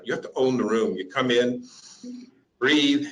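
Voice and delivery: usual speaking voice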